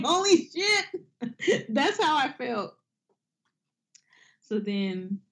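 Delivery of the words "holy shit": slow, high, laughing awe; Mister Krabs meme. Retold or imitated. Imitating the Mister Krabs meme, slow and high. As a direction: drawl it out like a stunned cartoon crab. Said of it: "the Mister Krabs meme".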